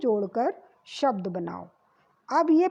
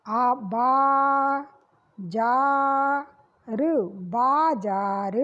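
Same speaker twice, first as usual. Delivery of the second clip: बाजार spelled out syllable by syllable, slowly; sounding out; much slower than usual. Shown as a demonstration. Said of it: "तो आ की sound आएगी".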